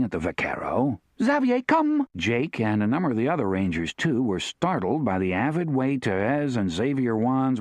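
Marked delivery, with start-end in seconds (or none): 1.2-2.1 put-on high French-accented call; French woman calling. A narrator's reading. Therese calling her husband over, French accent.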